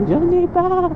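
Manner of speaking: high squeaky copy of her reply; mimicking passenger. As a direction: parrot her answer in a high voice.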